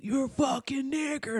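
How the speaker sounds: high, strained but soft mock yell. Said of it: "he gets mad".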